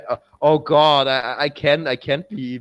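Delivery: ordinary talk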